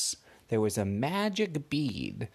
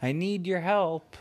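higher, pleading lion calling Sally; lion in the rain asks Sally for help, high and pleading; crying lion voice; 8 semitones higher than usual. Acted. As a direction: beg your friend for help like a sad lion. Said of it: "The lion was saying".

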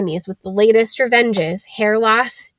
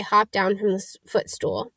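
ordinary delivery

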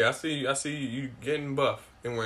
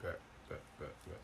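whispered; 7 semitones lower, 22 dB quieter than usual. Real, not acted. Whispered agreement.

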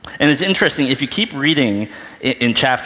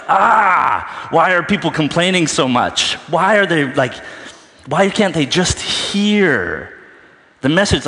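higher, louder exasperated rant; frustrated Jesus; 7 semitones higher, 3 dB louder than usual. Acted. Preacher voicing Jesus fed up with complaining cities, exasperated. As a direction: throw up your hands, why won't they listen. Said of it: "This is Jesus, right? He's just like".

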